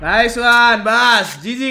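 loud victory praise; loud cheer. Real, not acted. Gamer praising teammates at match end, loud cheer. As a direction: cheer the team's win loudly.

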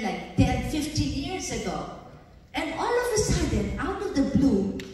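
ordinary talk

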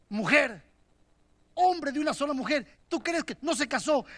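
ordinary delivery

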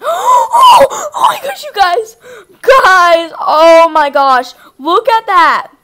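high, loud squeals of delight; thrilled, nearly shrieking; excited. Real, not acted. Discovering the bats area.

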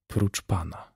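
soft, hushed addition. A narrator's reading. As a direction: add it under your breath. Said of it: "Głos jego opadł".

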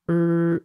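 うー as a held English R, tongue near the palate without touching; English R sound. Shown as a demonstration. Teacher showing learners the R principle, English R sound. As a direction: hold うー as a vowel-like "rrr" through a narrow tongue gap. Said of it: "舌と口外の間に隙間を作りながら声を".

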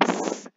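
S đọc như tiếng xì /s/ nhẹ; ending s sound. Shown as a demonstration. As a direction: hiss a soft s. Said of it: "Cái đầu tiên ha". (âm s vô thanh)

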